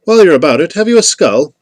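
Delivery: high, loud lady's call. A narrator's reading. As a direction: ask across the dark as a lady, voice lifted. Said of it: "came a lady's voice from off to the left".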